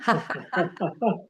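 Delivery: short burst of exercise laughter; laughing and shaking. Shown as a demonstration. Teacher urges class to keep shaking, bubbling laugh. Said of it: "Keep shaking and laughing".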